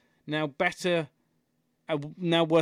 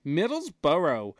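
ordinary delivery

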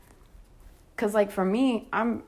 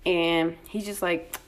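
ordinary talk, nothing marked

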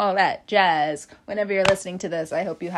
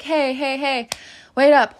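higher, rising to a loud call; hey, hey, then belt out wait up; 6 semitones higher, 6 dB louder than usual. Retold or imitated. Frat boys catching up with the friends, loud call.

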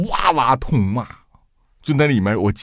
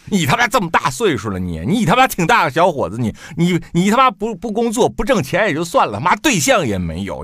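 furious ranting; 6 semitones higher than usual. Retold or imitated. Retold father's furious phone tirade.

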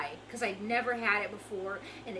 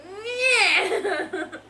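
high, loud bratty "nyeh"; petulant noise breaking into laughter; mock angry grunt; 10 semitones higher, 10 dB louder than usual. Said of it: "I was so mad at him".

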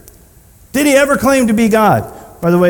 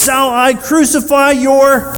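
raised, slow, high-pitched challenge; yelling; 7 semitones higher, slower than usual. Acted. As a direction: shout the question back, word by word. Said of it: "Pilate yells".

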